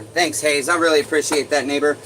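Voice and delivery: excited thanks. Real, not acted. Thanking a viewer mid-struggle.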